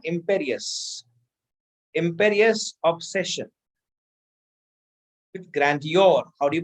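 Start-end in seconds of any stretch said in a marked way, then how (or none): 0.1-3.5 imperious slow and deliberate, said apart; stress on imperious. Shown as a demonstration. Teacher drills a vocabulary word for students, slow stress on imperious. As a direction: spell out imperious slowly so students catch it. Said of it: "इस word को mark कर लीजिएगा".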